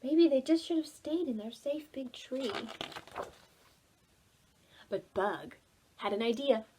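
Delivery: ordinary delivery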